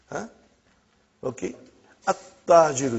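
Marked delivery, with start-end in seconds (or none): 2.1-3.0 "At-Tajiru" with a held double T, louder; tongue-touch T. Shown as a demonstration. Teacher shows tongue placement, "At-Tajiru" with a firm doubled T. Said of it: "your tongue has to touch".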